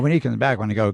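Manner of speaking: normal talking voice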